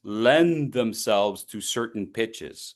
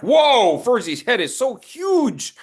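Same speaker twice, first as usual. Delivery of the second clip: mock amazement; 8 semitones higher, 4 dB louder than usual. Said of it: "you're like".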